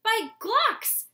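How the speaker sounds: father's high, loud delighted exclamation; exclaimed. Acted. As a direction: burst out in delight. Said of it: "Soren's father exclaimed".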